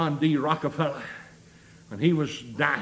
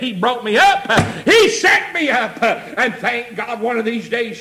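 rising preaching shout; 3 semitones higher, 6 dB louder than usual. Real, not acted. Preacher testifying how he was raised up, rising shout. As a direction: lift each phrase into a shout.

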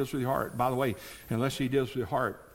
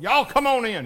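mock holler through the door; 10 semitones higher, 9 dB louder than usual. Man hollering to visitors at his door. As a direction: call out loud and high. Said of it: "I just hollered".

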